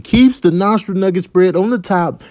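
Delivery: ordinary delivery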